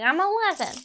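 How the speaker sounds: high imitation of own child self; little kid voice. Eleven-year-old telling the agent their age, high kid voice. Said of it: "And I was like".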